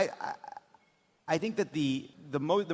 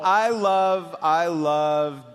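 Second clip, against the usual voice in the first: excited; 8 dB louder, slower than usual. Real, not acted. Excited, laughing riff.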